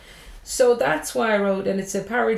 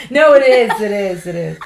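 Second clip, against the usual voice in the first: mock insistence; 10 dB louder than usual. Real, not acted. Joking insistence.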